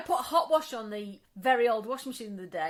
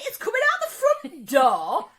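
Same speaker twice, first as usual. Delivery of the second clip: high, loud insistence, laugh breaking in; incredulous comic complaint; retold exasperation; 10 semitones higher, 13 dB louder than usual. Exasperated phone call with repairman.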